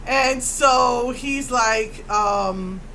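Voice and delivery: normal talking voice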